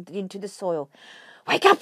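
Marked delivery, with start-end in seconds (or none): 1.5-1.8 loud, high alarm call; shouting. Acted. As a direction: shout them awake in panic. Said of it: "he shouted".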